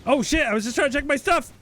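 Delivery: squeaky flustered protest; high-pitched panicked yelp. Real, not acted. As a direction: yelp at the monster to stop. Mob ambush while checking inventory.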